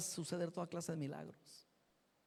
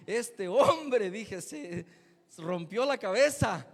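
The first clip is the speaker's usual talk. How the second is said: laughing; 9 dB louder, much slower than usual. Real, not acted. Laughing at fallen man.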